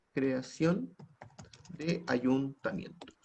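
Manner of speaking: slow, halting, word-by-word delivery; slow dictation. Real, not acted. Teacher writing up the last objective, slow dictation. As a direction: dictate softly, leaving gaps for writing.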